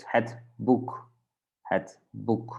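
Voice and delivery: boek with an 'oo' vowel. Shown as a demonstration. Teacher models the two-letter combination, oo sound in boek. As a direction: round boek like English 'book' with a tighter oo. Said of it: "then we say it like U".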